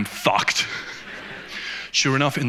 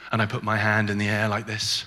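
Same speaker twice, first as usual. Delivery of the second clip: usual speaking voice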